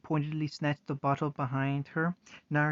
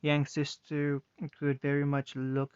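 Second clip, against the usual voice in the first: plain reading voice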